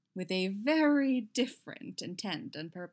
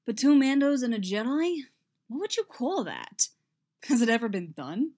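undeterred; 5 semitones higher, 6 dB louder than usual. A narrator's reading.

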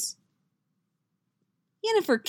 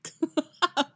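high bright burst of laughter; Jaskier's amused laugh; 9 semitones higher, 4 dB louder than usual. A narrator's reading. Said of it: "Jaskier laughs".

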